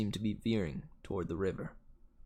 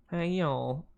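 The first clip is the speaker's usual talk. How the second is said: high Southern US warm-up phrase; Southern accent; 6 semitones higher than usual. Shown as a demonstration. Narrator finding the villain's voice, Southern accent. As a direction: find a drawn-out Southern twang, pitched up. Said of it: "sorry, Southern".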